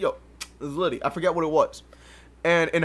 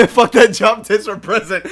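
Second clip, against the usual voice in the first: normal talking voice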